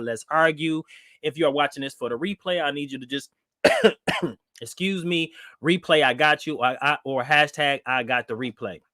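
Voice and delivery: ordinary talk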